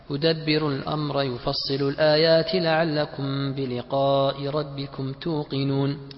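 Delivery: slow, measured Quran chanting; Quranic recitation. Shown as a demonstration. Student reads a verse example to teacher, Quranic recitation. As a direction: recite with long held vowels.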